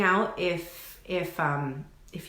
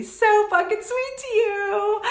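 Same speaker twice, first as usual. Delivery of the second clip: mock sweet; 5 dB louder, slower than usual. Sassy warning to entitled viewers.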